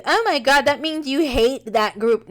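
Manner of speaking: judgy. Acted. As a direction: imitate someone judgy and scandalized. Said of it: "I don't want anybody to be judgy".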